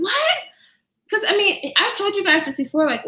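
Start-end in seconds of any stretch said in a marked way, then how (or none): 0.0-0.4 shocked what. Retold or imitated. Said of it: "I was like".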